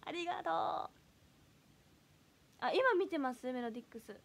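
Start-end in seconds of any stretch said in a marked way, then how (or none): 0.1-0.5 imitating Yuka. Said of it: "ゆかちゃんの真似したら怒る？".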